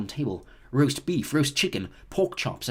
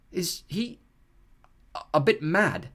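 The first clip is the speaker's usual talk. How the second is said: uncertain; 5 semitones higher, slower than usual. A narrator's reading. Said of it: "asked Percy uncertainly".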